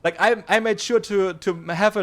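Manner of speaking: high, loud and fast insistence; heated. Real, not acted. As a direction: push back hard, voice rising. Heated self-defence about feedback.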